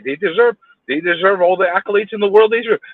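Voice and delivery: ordinary talk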